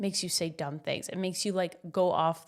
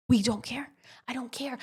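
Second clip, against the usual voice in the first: whispered; 4 semitones higher than usual. Real, not acted. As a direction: whisper it like a private mantra. Whispering mantra to herself.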